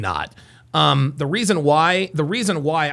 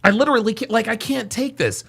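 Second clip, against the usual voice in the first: ordinary talk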